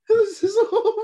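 high, slow words broken by laughter; laughing. Real, not acted. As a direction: wail it while laughing hard. Group cracks up at bad facts.

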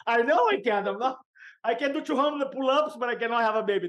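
hammed-up squeak of a man who can't take pain; mock-panicked self. Mock-protesting his own weakness.

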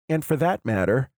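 usual speaking voice

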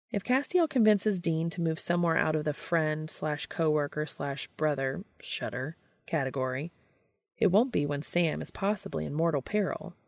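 plain reading voice

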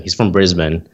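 usual speaking voice

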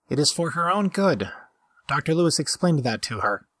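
a lightly voiced prissy robot, peevish and high; peevish droid. A narrator's reading. The green droid scolding the narrator about her own good, peevish and high. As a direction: whine it out like a fussy servant droid. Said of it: "the droid said peevishly".

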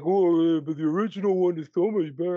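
fast, put-on contrarian voice; mock objector. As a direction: mimic a whiny know-it-all's pushback. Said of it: "and you're like".

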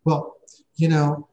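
a hint of a baffled office assistant; puzzled receptionist. Scheduler voiced in retold story.

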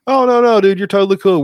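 high, loud breezy reassurance; mechanics reassuring. Retold or imitated. Mechanics telling the driver all is fine, loud. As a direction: brush it off like a laid-back mechanic. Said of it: "And they go".

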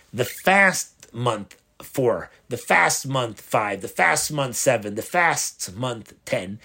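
slow, each "fast" leaned on before the number; teacher slowing to underline "fast" in each phrase; stress on fast. Shown as a demonstration. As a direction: spell out "the fast month four" word by word, landing on "fast". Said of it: "emphasize the word fast".

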